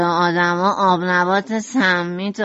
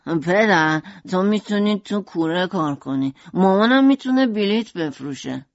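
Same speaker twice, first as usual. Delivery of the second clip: eager; 3 semitones lower than usual. A narrator's reading. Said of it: "ونسان با شوق ادامه داد".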